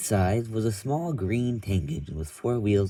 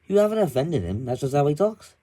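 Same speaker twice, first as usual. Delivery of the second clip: a hint of a higher engine voice; lightly voiced second engine; 7 semitones higher, 4 dB louder than usual. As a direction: explain it kindly. Explaining Ivor's whistle.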